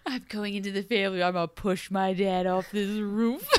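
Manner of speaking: lightly voiced sinister boy; hint of a menacing child; creepy kid voice. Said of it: "the young boy insinuates".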